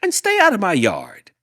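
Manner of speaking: high, loud order to leave; angry yell. Acted. Hank yelling at the jackrabbit to stay away. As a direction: shout him off the yard. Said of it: "Hank yelled back".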